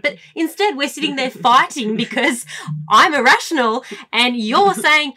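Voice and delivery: usual speaking voice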